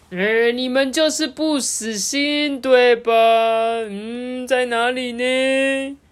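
high singsong with drawn-out endings; playful seeker. A narrator's reading. Doctor still playing hide-and-seek, singsong searching. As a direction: tease slowly while hunting around.

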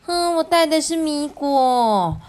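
high, slow, drawn-out character voice; gleeful classmate. Acted. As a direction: sing out your snack proudly like a child. Classmates happily share their snacks.

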